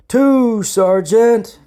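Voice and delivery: loud, high recruit's answer; shouted reply. A narrator's reading. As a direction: shout it back to the sergeant. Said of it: "I barely shouted".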